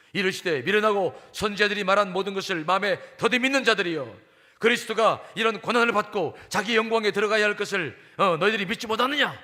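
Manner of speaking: loud, forceful scolding; 예수, angry rebuke. Acted. Jesus reproaching two disciples on the Emmaus road, angry rebuke. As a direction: preach it out as an indignant reproach. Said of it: "그러면서 화를 막 내십니다".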